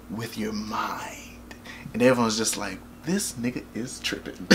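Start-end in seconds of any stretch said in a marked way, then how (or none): 0.1-1.5 slow, hushed, breathy reveal; imitated awestruck whisper, drawn out; mock-mystical whisper. Voicing the dad's mystical presentation. 3.1-4.5 hushed imitation of an unimpressed audience; muttered mock disbelief. Audience murmuring about the presenter.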